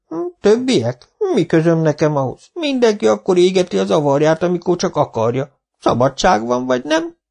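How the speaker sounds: high, mock-innocent old villager; sly old man. Old Zsibora brushing off the foreman, sly and high. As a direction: shrug it off slyly.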